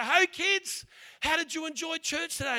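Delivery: imitating a cheerful mum; happy mum. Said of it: "one of those happy mums".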